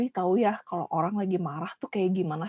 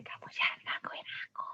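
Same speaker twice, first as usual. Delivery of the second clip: softer whispered scolding; whispered anger; 13 dB quieter than usual. Shown as a demonstration. Teacher shows the class that anger is not whispered, whispering. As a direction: hiss the warning under your breath. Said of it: "nggak mungkin ada yang bisik-bisik ya".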